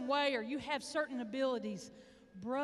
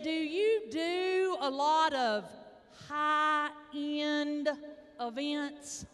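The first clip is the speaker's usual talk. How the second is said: sweet, sensitive caller; 2 semitones higher, 5 dB louder, much slower than usual. Retold or imitated. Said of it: "she was so sweet and just really trying to be very sensitive".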